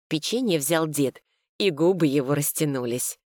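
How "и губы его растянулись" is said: ordinary delivery, nothing marked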